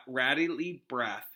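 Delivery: ordinary delivery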